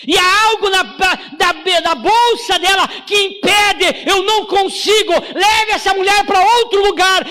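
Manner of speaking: preaching at full force. Acted. Pastor urging the congregation the enemy knows, fervent preaching. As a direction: hammer it home to the church.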